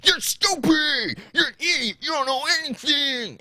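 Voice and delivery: a hammed-up high-pitched screaming fit; screaming mock tantrum. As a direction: squeal it like a spoiled brat storming out. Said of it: "and he goes".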